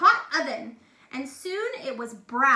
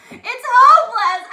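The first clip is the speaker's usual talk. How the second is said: very loud, high mock wail breaking into laughter; hammed-up wailing; 7 semitones higher, 16 dB louder than usual. Shown as a demonstration. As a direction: overdo the sob, then laugh. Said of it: "Is that how you would cry?".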